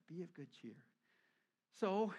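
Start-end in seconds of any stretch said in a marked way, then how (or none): 0.1-0.8 gentle comforting voice; tender, soft. Retold or imitated. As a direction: savor the words kindly. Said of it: "I like that".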